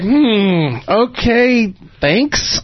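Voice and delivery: high-pitched, unsure mock gratitude; hesitant thanks. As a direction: act out an awkward, puzzled thank-you. Awkward reply to odd compliment.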